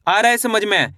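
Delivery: normal talking voice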